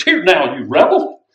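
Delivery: loud rebuke of the rebels; Moses shouting. Retold or imitated. Preacher voicing Moses, shouting at the rebels. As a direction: Moses yells at the grumbling people. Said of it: "He says".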